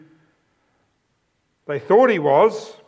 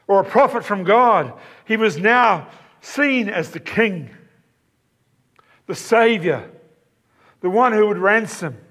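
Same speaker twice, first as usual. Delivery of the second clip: higher-pitched, building sermon voice; fervent preaching; 5 semitones higher than usual. Acted. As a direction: preach it with rising wonder. Rising preaching passage.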